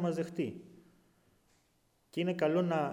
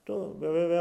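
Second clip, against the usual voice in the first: ordinary talk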